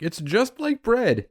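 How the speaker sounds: high, slow mocking singsong. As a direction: parody their fake enthusiasm. Mocking cauliflower pizza fans.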